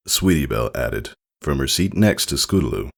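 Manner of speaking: plain reading voice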